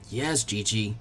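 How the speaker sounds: quiet, sheepish reply; lightly scolded. Acted. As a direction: mumble it like a scolded kid. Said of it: "as if he had just been lightly scolded".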